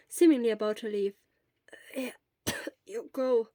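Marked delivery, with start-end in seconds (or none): 2.0-3.5 weak, rasping sick voice; barely gets the words out; hoarse and weak. A narrator's reading. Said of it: "That was all Shura could manage".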